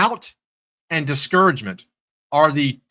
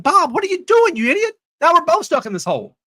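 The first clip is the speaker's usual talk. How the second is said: high-pitched mock outrage; exasperated scolding; 14 semitones higher than usual. Trapped man berates buddy who jumped in, acted exasperation. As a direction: scold a foolish friend, hammed-up.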